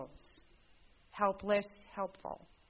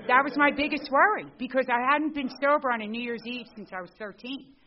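higher and much louder, pressing the point; emphatic; 6 semitones higher, 10 dB louder than usual. Real, not acted. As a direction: drive home how big the worry was. Stressing biggest worry.

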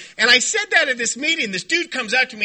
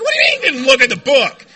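loud, disbelieving echo; incredulous outburst; 6 dB louder, faster than usual. Retold or imitated. Sponsee questions his sponsor's answer, loudly incredulous. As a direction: shout it back in mock disbelief. Acts own shocked reply to sponsor.

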